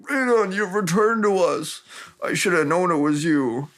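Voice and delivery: high, melodramatic reunion voice; mock overcome greeting. Royale greets the returned Raedon, hammed-up awe. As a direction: ham up a reverent welcome.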